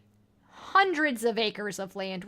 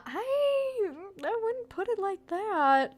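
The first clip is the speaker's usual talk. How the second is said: much higher, slower squeaky voice; higher put-on voice; 9 semitones higher, slower than usual. Player answering Gregor in a high put-on voice. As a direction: say it high and careful, like a nervous diplomat. Player re-says line in funny voice.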